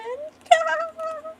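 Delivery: high, mocking villain laugh; villain cackle. Biero gloating over her death, high acted cackle.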